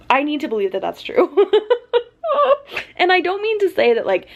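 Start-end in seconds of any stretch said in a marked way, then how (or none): 1.3-2.0 high laugh close to crying; whimpering laugh. Real, not acted. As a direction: laugh in a high, tearful whine. Emotional reaction to character.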